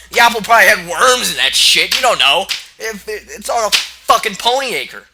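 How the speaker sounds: high, loud outraged ranting; exasperated rant. Real, not acted. Reader fed up with the story, loud high rant. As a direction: rant like the story insulted you.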